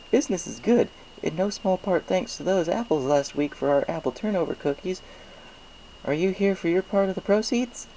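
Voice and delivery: high, friendly shopkeeper voice; chatty baker pleased with sales; cheerful baker voice. Acted. Baker character voice.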